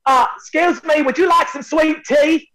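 high, slow Southern country drawl; country girl voice. Retold or imitated. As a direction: ask sweetly like a Carolina girl. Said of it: "She walks in and said".

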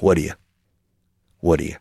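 "What do you" fused as whaddya; quick two-beat whaddya for "What do you"; reduced sounds. Shown as a demonstration. Teacher shows sounds learners miss, "What do you" as whaddya. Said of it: "not discriminating sounds".

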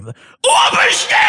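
slow, breathless whispered gasp; gasping for air. Retold or imitated. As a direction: gasp it out like you're winded. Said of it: "You gotta say it like you're out of breath".

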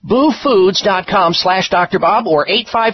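bright, punchy ad read; infomercial. Acted. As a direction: sell it with energy. Energetic sponsor ad.

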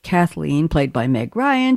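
usual speaking voice

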